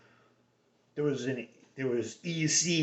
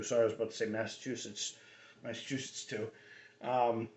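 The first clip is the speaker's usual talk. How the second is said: ordinary talk, nothing marked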